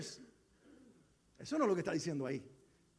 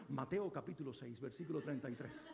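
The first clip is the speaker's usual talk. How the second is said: lower, softer mock Castilian narrator reading the verse reference; Spain Spanish audio Bible reader; 8 semitones lower, 10 dB quieter than usual. Pastor mimics his Bible app reading in a Spain Spanish accent, low and soft. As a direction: imitate the Bible app's Spain-Spanish voice, deep and hushed. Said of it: "tiene hasta acento español".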